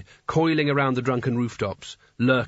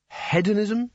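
much higher, pleading one-word guess; desperate guess; 9 semitones higher, slower than usual. A narrator's reading. Rincewind guessing Kanina's long word, desperate. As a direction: blurt it out as a last hope. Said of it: "said Rincewind desperately".

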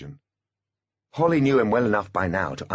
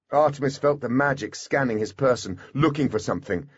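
plain reading voice

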